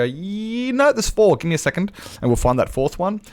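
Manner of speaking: acted-out helpful correction; retold hesitant correction. Acting own hesitant reply to regular.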